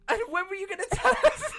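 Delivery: very high, loud laughing disbelief; real exasperated squeal through laughter; incredulous outburst. Real, not acted. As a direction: demand it in shrieking, laughing disbelief. Learns late of the crowbar.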